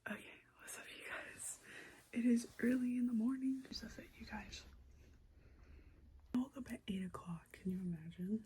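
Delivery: very soft, whispery vlog intro; hushed morning greeting. Real, not acted. Greeting viewers early in the morning, hushed. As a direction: keep it down, barely voiced.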